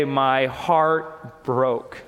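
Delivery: low, very slow and heavy. Real, not acted. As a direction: let each word sink with sorrow. Pastor recalls his own heartbreak.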